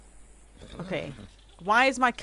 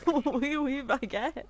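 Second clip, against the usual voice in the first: put-on playful French-accented phrase, higher; mock French; 6 semitones higher, slower than usual. Streamer obeying a viewer's silly request, mock French accent. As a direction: toss it off in a jokey French accent. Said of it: "Say, "Oui, oui, baguette".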